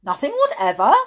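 loud, insistent question with a rise; persisting. A narrator's reading. King pressing Alice on her answer, loud insistent query. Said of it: "persisted the king".

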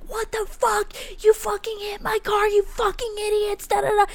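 high-pitched imitation of an angry yell; hammed-up furious screamer, held high; mock screaming. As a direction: rant high and strained, without full volume. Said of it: "he's fucking screaming".

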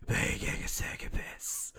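spend all your air before the last word; hammed-up voiceless menace; Batman running out of breath. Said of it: "just, like, running out of breath".

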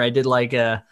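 ordinary delivery

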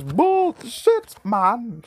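very high, loud catchphrase cry; loud high-pitched quote; Nicholas. Retold or imitated. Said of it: "In the immortal words of Nicholas".

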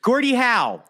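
high, hammed-up Canadian accent; playful Canadian voice naming hockey. Same jokey Canadian voice.

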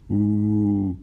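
loud drawn-out mocking "Uh"; teasing exclamation. A narrator's reading. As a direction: tease the grumbling passenger. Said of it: "exclamaron los muchachos".

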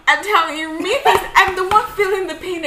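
loud, high-pitched venting; exasperated. Real, not acted. Woman venting about being told what she feels, exasperated. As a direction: protest it emphatically.

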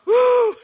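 a high, loud, real whoop of delight; joyful whoop. Real, not acted. Preacher to congregation, enjoying himself, with a loud joyful whoop. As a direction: let out a happy whoop.

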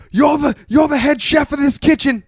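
high, loud berating; mock angry yelling. Owner to the head chef, retold angry yell. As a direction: imitate an owner chewing out the chef.

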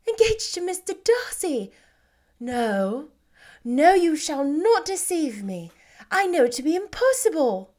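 a gentle elder sister refusing to believe it; Jane, incredulous. Acted.

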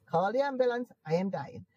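ordinary talk

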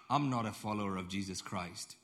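ordinary delivery

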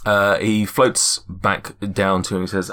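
usual speaking voice

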